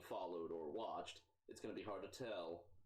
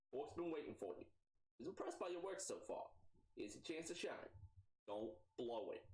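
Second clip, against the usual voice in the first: Mia, higher voice; 6 semitones higher than usual. Acted.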